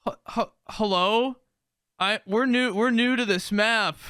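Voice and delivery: high, tentative stammered hello; timid newcomer. Retold or imitated. New player calling out to a stranger, timid. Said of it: "arguing married couple".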